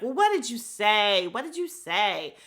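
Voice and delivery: loud, mock-aggressive questioning; angry demand. Robin jumping into the argument, mock angry demand. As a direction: retell her butting in, hard and loud. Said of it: "Robin is so angry".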